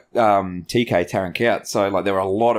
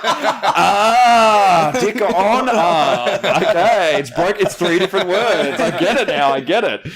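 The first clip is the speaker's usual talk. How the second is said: laughing realisation; 8 semitones higher, 4 dB louder, much slower than usual. Retold or imitated. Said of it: "I'm like".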